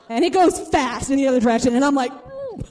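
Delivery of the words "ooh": tiny high, quiet queasy ooh; small high-pitched whimper of nausea. Retold or imitated. Rider as the ride switches direction, queasy high ooh.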